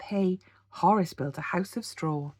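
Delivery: plain reading voice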